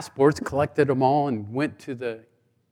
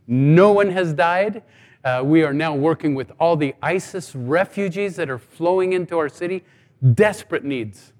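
ordinary delivery

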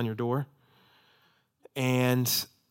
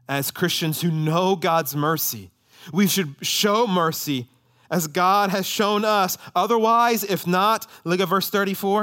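slow, loud, raised pulpit voice; forceful exhortation; 6 semitones higher, 5 dB louder, slower than usual. Real, not acted. Preacher urging Christians to show mercy, forceful exhortation. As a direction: press it home with deliberate force.

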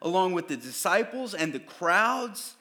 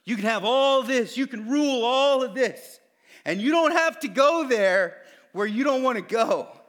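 lightly voiced tempter; 6 semitones higher, 8 dB louder than usual. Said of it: "Satan was offering him".

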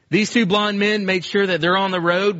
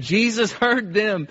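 ordinary delivery

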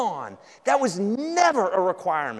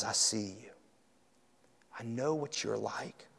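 low, hushed words of Jesus; soft, knowing; 8 semitones lower, 13 dB quieter than usual. Acted. Preacher voicing Jesus to the exposed listener, softly knowing. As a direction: speak low and very softly, straight to one heart.

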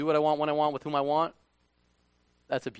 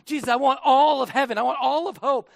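greedy believer; 7 semitones higher, 6 dB louder than usual. Retold or imitated. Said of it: "if you're like".

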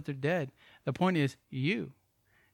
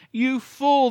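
stern rebuke; 8 semitones higher, 7 dB louder than usual. Acted. Jesus rebukes the rich fool.

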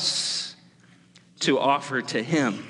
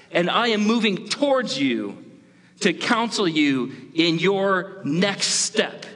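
God pleading with Cain; 7 semitones higher than usual. Acted. Preacher voicing God's urgent plea to Cain. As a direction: plead as God, urging Cain to rule over sin. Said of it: "He's saying, like".

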